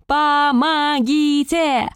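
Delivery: long, high, drawn-out yell. Acted. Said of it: "заорала громче".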